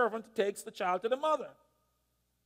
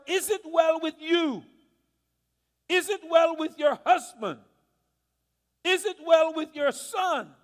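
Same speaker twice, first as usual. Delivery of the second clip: raised questions; 6 semitones higher, 5 dB louder than usual. Retold or imitated. Servant calls questions to her.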